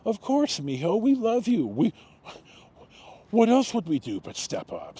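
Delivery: a foster mother reassuring the boy she took in, lightly voiced high and tender; loving mother. Her son thanks her, she answers high and tender.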